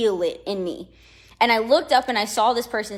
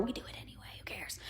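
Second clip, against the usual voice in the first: hushed conspiratorial whisper; 18 dB quieter, faster than usual. Real, not acted. To viewers admitting eavesdropping, whispered. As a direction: drop to a secret whisper.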